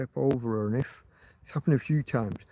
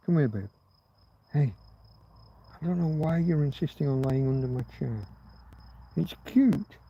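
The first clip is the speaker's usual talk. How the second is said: tender talk to the dog. Real, not acted. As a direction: gently reassure the dog. Talking softly to his dog.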